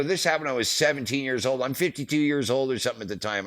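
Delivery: high-pitched indignant protest; fired up. Retold or imitated. Roadie arguing his old arrest with border cop, fired up. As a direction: argue back in exasperation, reenacted. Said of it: "get all fired up".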